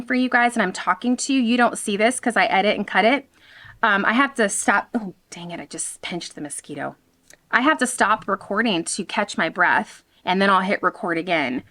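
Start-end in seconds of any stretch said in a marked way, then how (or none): 4.9-6.9 muttered aside. Real, not acted. Muttered aside about mosquito bite.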